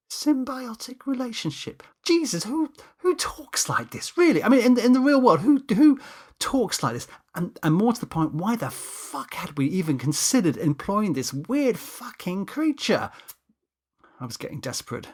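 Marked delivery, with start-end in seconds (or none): 0.1-7.1 incredulous, much higher, louder in the middle; scoffing in disbelief at the jargon. Acted. Narrator scoffing at Danny's pompous jargon, incredulous. 7.3-13.1 exasperated. Acted. Narrator ranting about Danny.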